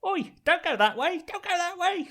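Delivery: very high, squeaky Cockney creature voice; high-pitched urgent Cockney warning; little Cockney worm. Acted. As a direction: pipe up as a tiny Cockney worm. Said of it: "The little cockney worm".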